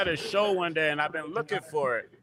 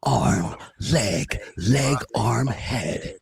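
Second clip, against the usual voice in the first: lightly put-on lower voice; 5 semitones lower, much slower than usual. Showing the guys many voices, put-on voice. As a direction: chant the words in an assumed, lower voice. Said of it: "How many voices do you want".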